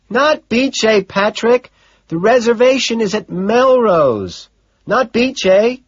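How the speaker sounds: a secretary passing on messages; Gene's voice. Acted. Secretary correcting restaurant name.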